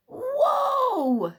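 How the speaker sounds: loud, high drawn-out "whoa!". Acted. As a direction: cry out as if thrown off a flying door. Thrown off flying door, exclaiming.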